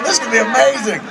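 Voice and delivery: high, quick retold enthusiasm; gushing excitement. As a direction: rush it out like a thrilled friend. Retelling friend gushing about the film.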